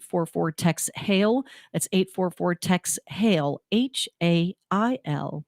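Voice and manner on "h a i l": ordinary talk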